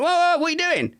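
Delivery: high, startled "whoa, whoa"; alarmed protest. Retold or imitated. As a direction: stop her mid-cut, alarmed. Retold alarm at the hairdresser.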